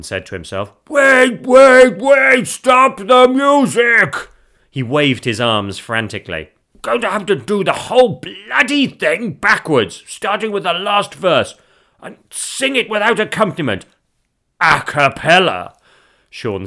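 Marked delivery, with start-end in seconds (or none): 0.9-4.3 very loud, high, urgent calling out; frantic shout. Acted. Husband waving his arms to stop the music, frantic shouting. 6.8-15.7 loud, high-pitched theatrical announcing; flustered showman. Acted. Husband redoing his whole performance backwards, loud flustered showmanship.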